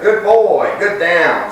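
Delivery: praising a dog in training; dog praise. Real, not acted. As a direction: reward him with your voice. Praising the dog mid-talk.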